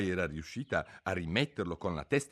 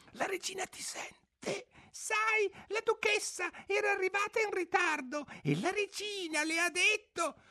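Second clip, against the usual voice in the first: terrified rabbit. A narrator's reading. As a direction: whisper in panic like a scared rabbit. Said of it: "pigliò il coniglio terrorizzato".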